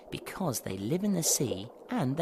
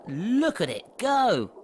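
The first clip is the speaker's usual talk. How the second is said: excited; 8 semitones higher, 8 dB louder than usual. Real, not acted. Presenter marvels at fast marlin.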